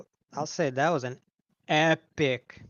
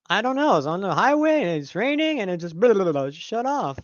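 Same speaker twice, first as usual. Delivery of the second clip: quoting friend; 5 semitones higher, 4 dB louder than usual. Retold or imitated. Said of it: "He's like".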